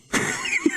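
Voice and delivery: breathy giggle up high; sudden high-pitched giggle; high squeaky laugh. Real, not acted. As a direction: let a high laugh slip out. Laughs at his own stumble.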